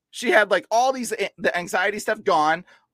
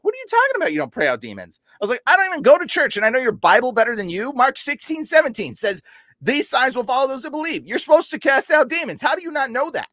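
heated mock rant, pitched high and louder; retold chewing out; 10 semitones higher, 5 dB louder than usual. Retelling scolding pastors over demons, heated high rant. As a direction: scold the pastor like he should know better, raised and loud. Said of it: "chewed out a few pastors".

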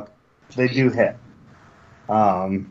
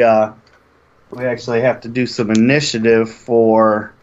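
ordinary talk, nothing marked

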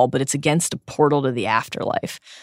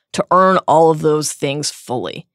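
ordinary talk

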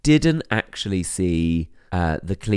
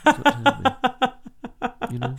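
laughing; much slower than usual. Real, not acted.

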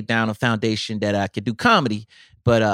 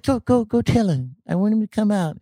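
[name] voice; 9 semitones higher than usual. Retold or imitated. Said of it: "He was like".

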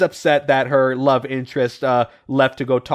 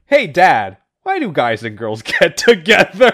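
son's question breaking into laughter; loud, laughing mock-innocent question; cracking up; 6 semitones higher, 4 dB louder than usual. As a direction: read it louder and dissolve into giggles.

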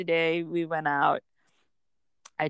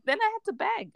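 amused disbelief. Real, not acted. Mother laughing at her defeat.

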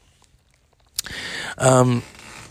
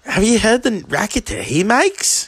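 much higher, indignant devil; mock outrage from the devil; hammed-up Satan griping; devil complaining; 9 semitones higher than usual. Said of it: "Gary Oldman's like".

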